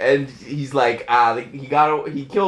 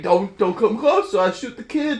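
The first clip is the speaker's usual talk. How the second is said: threatening; 7 semitones higher than usual. Acted. Spy threatens with gun.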